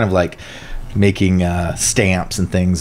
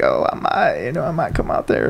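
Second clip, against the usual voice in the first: imitating Bill Clinton; 3 semitones higher, 5 dB quieter than usual. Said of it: "He's like".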